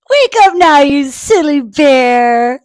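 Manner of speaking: high, loud, drawn-out wake-up call; rousing call. Acted. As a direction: call out to rouse a sleeper, stretching the words. Animals rouse sleeping Bear.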